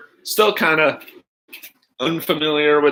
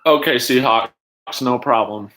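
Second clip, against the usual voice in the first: normal talking voice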